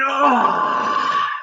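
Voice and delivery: high, hammed-up cry of release; mock climax groan. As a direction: let out a fake climactic "Ah". Mock climax after the countdown.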